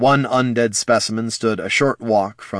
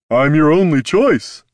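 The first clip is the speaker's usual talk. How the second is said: higher, louder, cocky trader; boastful merchant voice; 7 semitones higher, 7 dB louder than usual. Acted. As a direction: sell it like a smug dealer. Clubs boasting.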